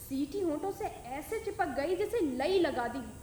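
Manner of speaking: usual speaking voice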